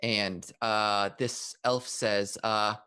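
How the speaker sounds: usual speaking voice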